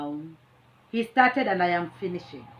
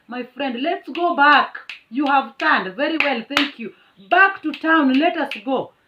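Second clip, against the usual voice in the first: louder, higher defiant orders; defiant retort; 6 semitones higher, 8 dB louder than usual. Retold or imitated. Telling the driver to turn back, loud defiant. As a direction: bark it back at him.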